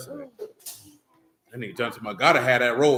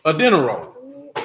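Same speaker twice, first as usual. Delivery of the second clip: slow, drawn-out disbelief; incredulous repeat; 2 semitones higher, 4 dB louder, slower than usual. Acted. Ribbing friends over their dinner roll breakfast, drawn-out disbelief. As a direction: stretch it out like you can't believe it.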